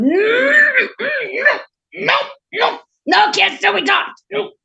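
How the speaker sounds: high, loud frog refusals; stubborn frog. Acted. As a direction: squawk out the frog's firm refusal. Frog refuses the princess a kiss.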